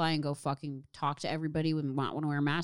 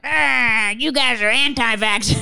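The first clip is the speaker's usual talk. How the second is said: louder, higher accusing yell; imitating angry online commenters; hammed-up accusation; mock accusing; 7 semitones higher, 9 dB louder, slower than usual. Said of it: "people are gonna be like".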